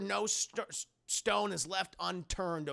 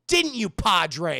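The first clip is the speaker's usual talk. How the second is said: taunting; 10 dB louder, slower than usual. Retold or imitated. Possessed girl taunting the priest.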